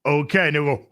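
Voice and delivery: ordinary delivery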